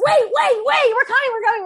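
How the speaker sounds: high, loud shout after a departing boat; calling out. Retold or imitated. Friends running for the ferry, calling out to the crew. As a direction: yell to make them wait for you.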